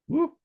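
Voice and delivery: short high-pitched woo; brief high whoop; playful woo. Real, not acted. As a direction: let out a quick, loud "woo". Playful cheer before the reading.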